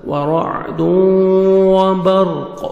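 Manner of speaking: slow, drawn-out melodic tarteel; Quran recitation. Shown as a demonstration. Tajweed teacher reciting a verse for students, melodic and slow. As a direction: chant each syllable, stretching the vowels.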